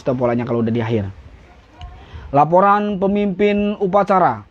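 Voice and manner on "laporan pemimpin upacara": slow, measured formal report; laporan upacara. Shown as a demonstration. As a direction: report like a ceremony leader. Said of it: "nggak usah lagi". (laporan resmi, pelan dan teratur)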